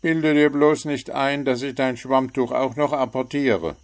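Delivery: a chirpy bird poking fun; cheeky little bird. A narrator's reading. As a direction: frecher kleiner Vogel. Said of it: "meinte nur schlicht".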